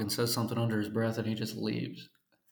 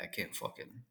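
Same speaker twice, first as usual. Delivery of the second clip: hushed on the bus; 5 semitones lower, 14 dB quieter than usual. Retold or imitated. On a bus full of people.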